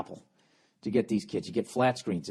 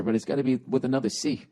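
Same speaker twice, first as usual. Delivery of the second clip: ordinary talk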